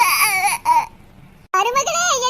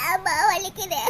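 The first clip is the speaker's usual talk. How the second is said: much lower, softer whimper of pain; mock crying; 10 semitones lower, 6 dB quieter than usual. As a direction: whine like a child who is hurting. Daughter cries in pain.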